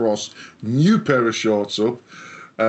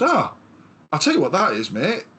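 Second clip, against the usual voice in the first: pitched up, imitating the know-all lad; knowing coworker; 7 semitones higher than usual. Coworker explaining the car fault, raised imitating voice. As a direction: play the bloke who's sure he knows.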